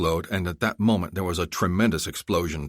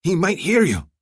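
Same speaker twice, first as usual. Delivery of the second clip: nervous warning; 12 semitones higher, 5 dB louder than usual. Acted.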